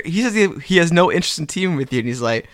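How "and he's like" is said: ordinary talk, nothing marked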